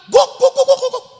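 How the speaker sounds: rapid string of high woofs; imitated dog barking. Dog charging off after the goat, imitated barking. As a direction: bark repeatedly, high and excited, like a running dog. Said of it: "anjingnya lari".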